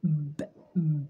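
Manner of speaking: ب said as a bare stopped b with no vowel; ب، ب as a short closed b sound; bare sukun sound. Shown as a demonstration. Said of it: "باء ساكنة بإيه؟".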